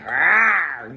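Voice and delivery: loud mock roar; playful lion roar. Imitating a lion's roar.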